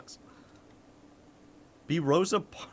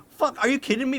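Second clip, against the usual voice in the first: high, louder burst of disbelief; incredulous; 10 semitones higher, 5 dB louder, faster than usual. Real, not acted. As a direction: react in sharp disbelief. Reacting to a question about British accents.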